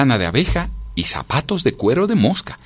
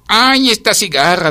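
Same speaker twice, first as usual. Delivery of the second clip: loud, high, drawn-out grumble; fed-up ant groaning at a noisy neighbour; exasperated complaint; 12 semitones higher, 5 dB louder, slower than usual. A narrator's reading. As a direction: stretch it out in annoyance. Ant complaining about cicada's noise.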